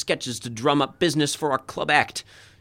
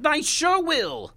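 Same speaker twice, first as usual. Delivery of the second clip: frantic yelling; 12 semitones higher, slower than usual. Acted. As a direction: scream them apart. Producer shrieks at fighting pair.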